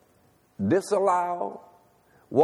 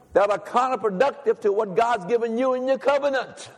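preaching at full force; 8 semitones higher than usual. Acted. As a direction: preach it out to the church.

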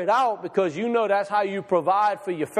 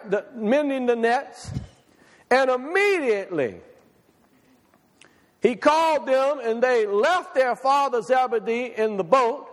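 raised-pitch, emphatic preacher's reading; scripture reading; 6 semitones higher than usual. A narrator's reading. Preacher reading scripture to the congregation, high and declamatory. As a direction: read the verse out high and emphatically.

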